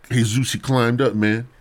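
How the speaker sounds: ordinary talk, nothing marked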